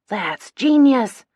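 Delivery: yelling. A narrator's reading. Lila cheering the fake kidnapping plan, loud yell. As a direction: yell it out, thrilled by the idea. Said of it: "Lila yells".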